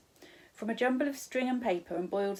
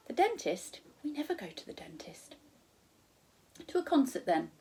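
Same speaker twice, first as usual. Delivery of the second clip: put-on higher girl's voice, puzzled; child Sophie; 6 semitones higher than usual. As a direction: lift into a girlish surprised query. Sophie protests to Charles.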